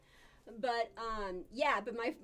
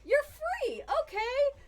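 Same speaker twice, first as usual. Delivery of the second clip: high, loud, slow dismay at the escaped pet; sing-song shock, drawn out; comic high alarm, then okay; mock surprised; 14 semitones higher, 9 dB louder, slower than usual. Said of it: "And I'm like".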